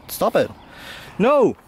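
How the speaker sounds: ordinary talk, nothing marked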